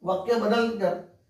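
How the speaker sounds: ordinary talk, nothing marked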